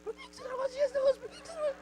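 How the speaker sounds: put-on haunting child voice. The question haunting the man in bed, high soft child voice. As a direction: whisper it into his sleepless night. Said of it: "tava deitado na cama".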